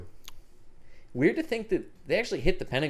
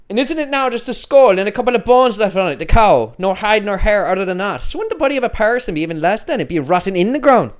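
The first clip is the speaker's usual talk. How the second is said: stage Irish, higher and louder; Irish accent; 9 semitones higher, 8 dB louder than usual. Acted. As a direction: deliver the play line in Irish. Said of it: "say a line that's really confusing".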